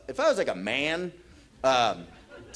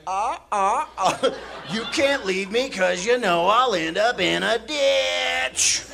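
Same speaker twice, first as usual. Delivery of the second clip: raised, loud sing-song taunt; gleeful hammed-up defiance; mock taunting; 6 semitones higher, 5 dB louder, slower than usual. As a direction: crow it at them, stretching the last word.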